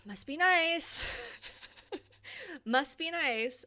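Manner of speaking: high, loud, drawn-out jealous whine; comically envious, stretched out; mock envy. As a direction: play up the envy, slow and high. Mock envy at being single.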